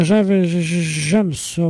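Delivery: ordinary delivery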